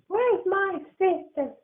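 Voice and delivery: put-on high slow anxious kid voice; a child missing his sister; high boyish fret; worried little boy.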